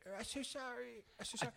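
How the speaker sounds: high, put-on apologizing voice; apologetic neighbour lady. Man imitating embarrassed neighbour apologizing, high lady voice. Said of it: "She's like".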